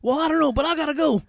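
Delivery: very high, hammed-up brush-off; mock husband ducking out; dismissive husband. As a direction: wave her off and leave.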